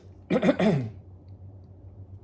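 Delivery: harsh, loud coughing fit; real choking cough. Real, not acted. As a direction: cough hard, choking on food. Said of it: "I'm choking".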